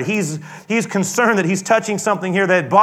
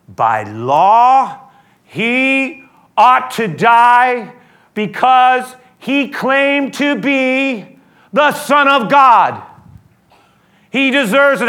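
very slow, loud, high accusation; insistent accusers; 6 semitones higher, 6 dB louder, much slower than usual. Retold or imitated. The priests press Pilate for death, slow and insistent. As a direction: drag out the charge, demanding.

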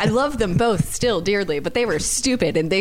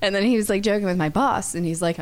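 normal talking voice

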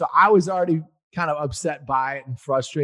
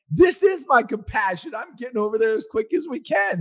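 defensive; 8 semitones higher than usual. Retold or imitated. Arguing with wife at sign.

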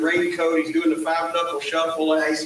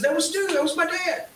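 high, insistent imitation; retold panicky protest; mock alarm; 11 semitones higher than usual. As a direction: pipe up urgently, pitched well up. Retold second man confirms the caller.

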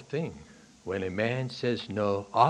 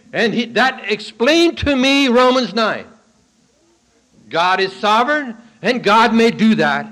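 full-force preaching; 12 semitones higher, 15 dB louder than usual. Real, not acted. Preacher explaining Romans nine to the church, booming. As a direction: thunder the warning from the pulpit.

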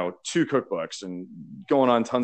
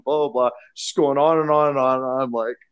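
ordinary talk

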